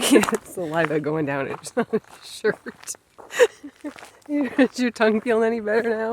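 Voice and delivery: laughing through the words. Real, not acted. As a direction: laugh as you ask. Laughing at drooling husband.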